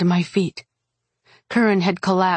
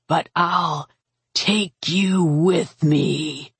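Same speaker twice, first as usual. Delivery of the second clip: very slow, drawn-out dragon threat; slow, menacing last breath; dying dragon's whisper; much slower than usual. A narrator's reading. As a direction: drag out each word as a dying beast's vow. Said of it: "the dragon whispered".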